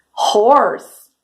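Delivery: ordinary delivery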